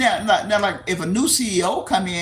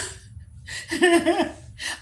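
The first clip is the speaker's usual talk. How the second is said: airy laugh; 11 semitones higher than usual. Real, not acted. Laughs at own phrasing.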